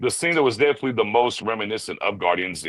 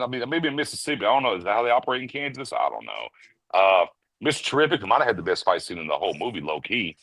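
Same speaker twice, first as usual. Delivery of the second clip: ordinary talk, nothing marked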